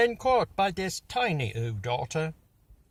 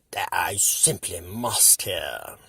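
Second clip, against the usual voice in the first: grinning Grinch; 7 semitones lower than usual. Acted. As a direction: savour it with a wicked grin. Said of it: "grinned the Grinch".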